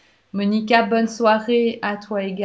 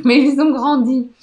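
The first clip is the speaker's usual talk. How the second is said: laughing; 5 semitones higher, 8 dB louder than usual. Real, not acted. Reading a viewer's joking comment.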